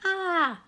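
high, loud imitated "ah"; mock scream. A narrator's reading. Boy recalls brother running from August, mock scream. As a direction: imitate a small boy's yell.

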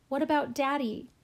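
put-on a child crying out in protest. A narrator's reading. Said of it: "I cried".

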